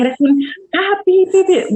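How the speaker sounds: high mock censor beeps in place of swear words; retold rant at the sky with the swearing bleeped; bleeped-out insults. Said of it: "groserías enormes".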